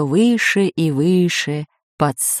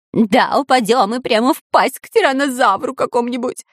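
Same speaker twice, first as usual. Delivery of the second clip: higher whining voice; 5 semitones higher than usual. A narrator's reading. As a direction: whimper out the worst fear. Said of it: "скулил Дырка".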